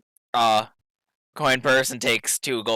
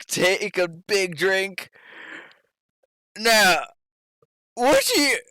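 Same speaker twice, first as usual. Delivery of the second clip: lightly acted drunk, pitched up; drunk question; 6 semitones higher, slower than usual. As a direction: play a drunk starting a question. Acting drunk patron mid-question.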